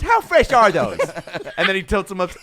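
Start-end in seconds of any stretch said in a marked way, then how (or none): 0.0-1.0 put-on high, loud little kid asking. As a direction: squeal it like a greedy kid. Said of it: "some little fat kid walks into a room and goes".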